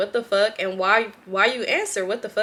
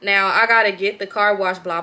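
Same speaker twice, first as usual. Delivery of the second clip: ordinary talk, nothing marked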